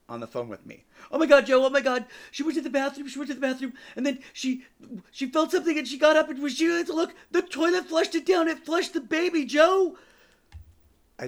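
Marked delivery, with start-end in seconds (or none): 1.1-10.0 very high, loud panicked shrieking; imitated hysterical screaming. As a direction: shriek the news, stumbling, as a hysterical caller.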